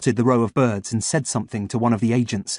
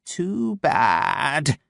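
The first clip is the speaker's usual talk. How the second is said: venomous crow; 4 semitones higher than usual. Acted. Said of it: "with venom in her throat".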